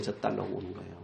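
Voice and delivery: hushed, inward repetition; soft echo. Real, not acted. Preacher echoing his own point, soft and low.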